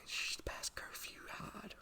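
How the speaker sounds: slow, hushed whisper to hide from a cop; acted secretive warning, all breath; urgent whisper. Acting kids hiding from a cop.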